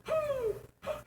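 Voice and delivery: high mock owl hoots. Dad leading the girls in hooting, high owl hoots. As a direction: hoot out over the water like an owl. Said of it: "we'll all hoot".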